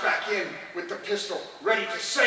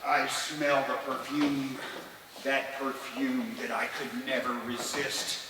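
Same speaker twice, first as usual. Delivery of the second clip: slow, low, savoring each phrase; entranced; 4 semitones lower, 5 dB quieter, slower than usual. Acted. Husband recalling wife's perfume, slow and entranced.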